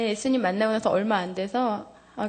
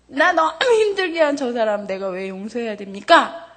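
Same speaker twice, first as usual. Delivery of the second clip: retold angry prayer; 7 dB louder than usual. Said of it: "막 화를 냈죠".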